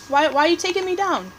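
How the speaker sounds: ordinary talk, nothing marked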